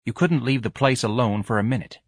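ordinary delivery

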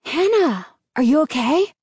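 a touch of alarmed concern. A narrator's reading. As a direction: ask with worried urgency. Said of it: "features tight with concern".

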